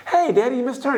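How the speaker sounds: put-on high-pitched kid piping up; child calling dad. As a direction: imitate a boy alerting his father. Brother as child calls to dad.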